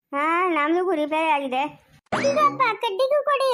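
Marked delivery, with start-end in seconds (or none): 2.1-3.5 high-pitched pestering request; calling out. Acted. Neighbour calls out for the stick. (ಎತ್ತರದ ದನಿಯಲ್ಲಿ ಕೂಗಿ ಕೇಳುವುದು)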